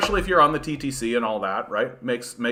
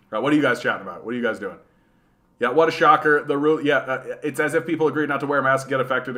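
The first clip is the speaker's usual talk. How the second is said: normal talking voice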